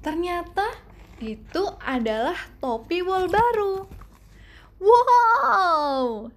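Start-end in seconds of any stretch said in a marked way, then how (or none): 4.8-6.3 loud, high delighted wow; child thrilled by his new hat; excited exclaim. A narrator's reading. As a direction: gasp with joy at the gift. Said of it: "seru Douglas".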